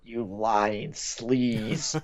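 very slow, drawn-out accusation; slow mock-menacing hero voice; Kevin Conroy's Batman. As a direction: imitate a superhero's line, stretching each word. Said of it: "Kevin Conroy ... remember the last one?".

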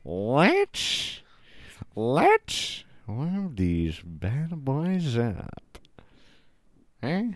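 very slow, stretched-out mock imitation; mimicking her drawl. Host copying the clip woman's funny line, exaggerated slow mimicry. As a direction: drag every word out, copying her. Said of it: "she says it is pretty funny".

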